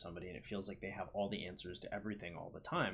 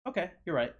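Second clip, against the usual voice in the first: much higher, louder frog voice; frog giving in; 10 semitones higher, 11 dB louder than usual. Acted. Frog agrees to carry the scorpion, high loud agreement. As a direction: pipe up loudly as the persuaded frog.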